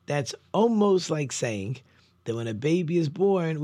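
ordinary talk, nothing marked

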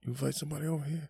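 high, fast alarmed question; mock panic. Panicked relative asking who invited someone over, fast mock alarm. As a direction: scramble as if under attack.

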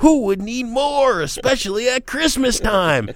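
hammed-up Dylan rhetorical question; [name] impression. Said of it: "I'm gonna do my Dylan for you".

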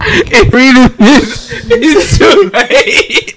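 high, loud, drawn-out laughing read; laughing through it. Real, not acted. Friend cracking up over a bungled reading, laughing high and loud. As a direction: squeal the words out through laughter.

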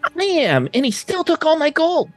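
high, whiny complaining voice; Woford's high voice. Acted. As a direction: whine about the lost gold. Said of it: "I'm slipping into the otter's voice now".

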